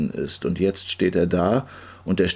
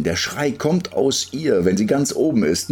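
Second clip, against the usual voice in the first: plain reading voice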